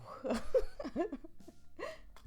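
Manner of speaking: high-pitched giggle. Real, not acted. Streamer tickled by their own crush, high giggle. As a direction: giggle helplessly after swooning. Giggling after swooning over accents.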